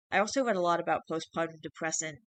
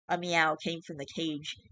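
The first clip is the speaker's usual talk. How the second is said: ordinary delivery, nothing marked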